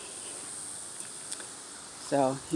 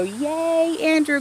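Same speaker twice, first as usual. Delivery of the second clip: a bright, much higher burst of joy; excited cheer; 11 semitones higher, much slower than usual. Real, not acted. Mom celebrating her son's engagement to viewers, excited cheer. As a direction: cheer his name like a proud mom.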